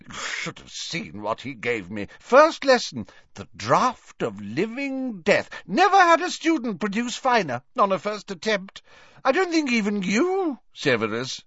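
high, gushing professor with swoops; tipsy Slughorn, raving. Acted. Excited professor tells a colleague of a first lesson, tipsily effusive. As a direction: rave about the pupil, voice leaping high.

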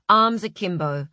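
usual speaking voice

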